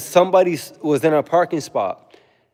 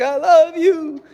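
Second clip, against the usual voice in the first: high, slow whiny "I love you"; mock girly voice; 4 dB louder, much slower than usual. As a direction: drag it out high and pleading. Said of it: "She's like".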